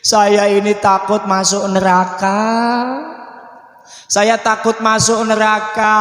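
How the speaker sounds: slow, level, drawn-out whine; mock-tearful child's confession; crying child. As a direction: whimper the fear with long-held endings. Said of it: "anaknya sambil nangis begini".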